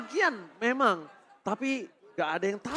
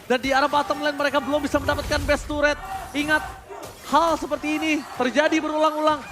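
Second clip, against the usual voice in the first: louder, punchy esports casting; fighting game announcer; 2 semitones higher, 4 dB louder than usual. Acted. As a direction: hype up every line for the crowd. S0 casting the match.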